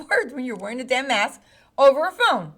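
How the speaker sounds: ordinary talk, nothing marked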